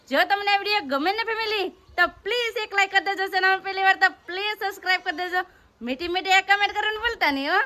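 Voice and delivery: high, sweet like-and-subscribe ask; vlog outro plea. Acted. Host sweetly asks her viewers to like and subscribe.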